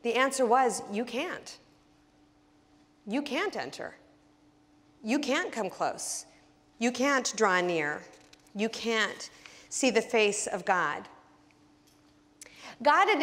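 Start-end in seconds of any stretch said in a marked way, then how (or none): 0.9-11.1 softer, slow, stress on "can't" each time; solemn refrain. Acted. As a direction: let each "can't" land slow and quiet. Preaching the old answer.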